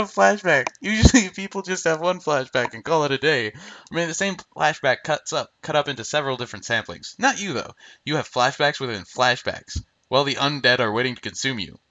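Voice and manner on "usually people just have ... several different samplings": ordinary talk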